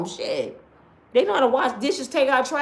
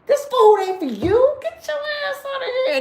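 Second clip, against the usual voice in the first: high angry yell; 12 semitones higher, 4 dB louder than usual. Acted. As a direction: chase them out shouting. Yelling at roaches.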